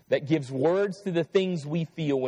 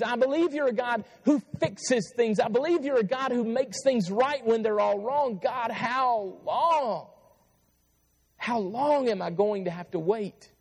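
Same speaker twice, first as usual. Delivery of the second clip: raised, urgent prayer to God; pleading prayer; 10 semitones higher than usual. Shown as a demonstration. Preacher voicing a prayer to God, pleading. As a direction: cry out to God, begging how long.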